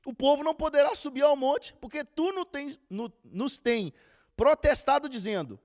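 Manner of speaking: loud, fervent preaching; preaching at full force. Acted. Preacher retelling Sinai to the congregation, loud fervent preaching. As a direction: proclaim it to the whole congregation.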